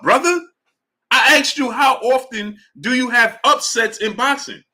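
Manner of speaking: raising the voice at a stubborn chatter; exasperated rant. Real, not acted. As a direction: argue in disbelief. Heated argument with chatter.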